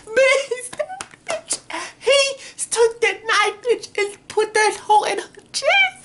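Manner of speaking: high-pitched shrieking disbelief, laughing and crying; shocked hysteria. Real, not acted. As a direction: lose it completely over the twist. Shocked reaction to the stabbing.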